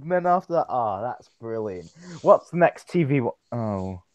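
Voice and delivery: ordinary talk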